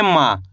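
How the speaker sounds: माँ as a long, falling syllable; letter-sound demo. Shown as a demonstration. Teacher answers what we read, letter-sound demo. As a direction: draw out माँ and drop low. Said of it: "क्या पढ़ेंगे?".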